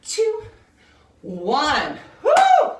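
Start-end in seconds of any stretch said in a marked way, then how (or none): 2.2-2.7 excited whoop. Real, not acted. Cheer after finishing the set.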